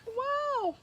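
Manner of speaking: very high, delighted squeal of surprise; amazed gasp. Retold or imitated. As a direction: shriek with joy at the mirror. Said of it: "And I'm like".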